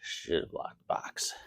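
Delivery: quiet unvoiced whisper to himself. Real, not acted. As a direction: whisper it under your breath. Muttering to himself in the garage.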